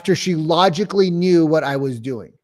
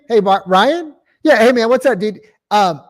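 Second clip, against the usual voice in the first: higher, louder playful call opener; having fun; 6 semitones higher, 8 dB louder than usual. Shown as a demonstration. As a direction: bounce in with fun energy. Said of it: "I have fun. Y'all, I'm having fun here".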